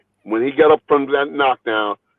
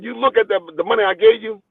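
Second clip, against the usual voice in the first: higher, pressing voice; insistent promoter; 7 semitones higher than usual. Retold or imitated. Promoter pointing the boxer to the money, pitched up and insistent. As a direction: push the point at him.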